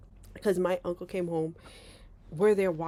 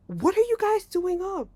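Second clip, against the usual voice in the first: high, louder startled question; frightened; 12 semitones higher, 5 dB louder than usual. Retold or imitated. Frightened uncle finding kids awake, alarmed question. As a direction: act out a startled uncle, pitched up. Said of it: "He's frightened too because he's like".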